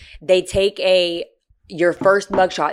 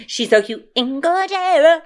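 gushing coo; 10 semitones higher, slower than usual. Real, not acted. Cooing over friend's photo.

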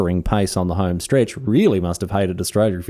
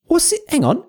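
mock disbelief. Reacting to insulting diary line.

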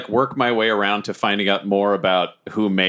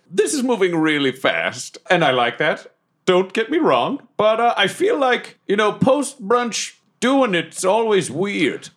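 higher, swooping theatrical voice; grandiose masked suitor; 11 semitones higher, slower than usual. Acted. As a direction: draw words out with big pitch swoops. Plays Deathwolf on a date.

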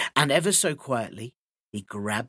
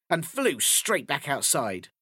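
ordinary delivery

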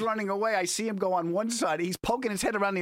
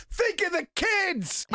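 slow pleading call; 7 semitones higher, slower than usual. Retold or imitated. Husband calling back to wife in the drugstore, slow and pleading. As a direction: draw out think of the kids slowly. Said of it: "and I go".

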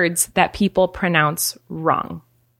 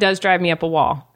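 ordinary talk

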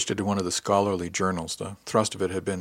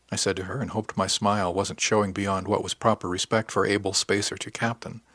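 usual speaking voice

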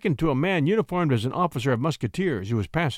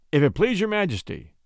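higher, mildly harsh interruption; 7 semitones higher than usual. A narrator's reading. Duke interrupting the poet before the king, harshly. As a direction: cut in with a touch of harshness. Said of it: "interrupted a harsh voice".

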